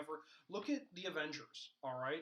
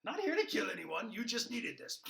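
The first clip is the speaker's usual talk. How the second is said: mock Joker; 7 semitones higher, 5 dB louder than usual. Joker voiced throwing pie at Batman.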